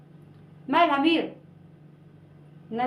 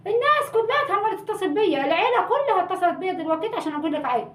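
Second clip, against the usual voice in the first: exasperated; 7 semitones higher than usual. Acted. Exasperated about whole family calling.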